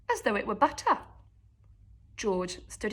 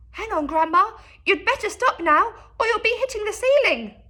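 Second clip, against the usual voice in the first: high, loud warning; alarmed call; 8 semitones higher, 5 dB louder than usual. A narrator's reading. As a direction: call out to stop her. George warns growing Grandma.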